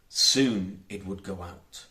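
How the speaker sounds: ordinary delivery, nothing marked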